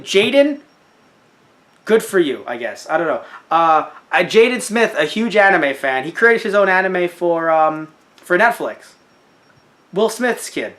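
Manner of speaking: usual speaking voice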